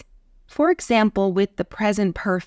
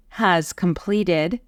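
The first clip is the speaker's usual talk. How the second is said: ordinary talk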